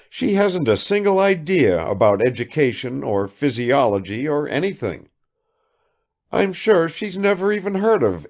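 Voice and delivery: high, rapid-fire chatter of a vain lady; affected negligence. A narrator's reading. Kukshina showers Bazarov with questions, high and affected. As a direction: pour out questions without waiting.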